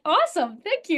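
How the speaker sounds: high, bright retold thanks; breezy delight. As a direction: chirp a cheerful, surprised acceptance. Retells glad reply to coach.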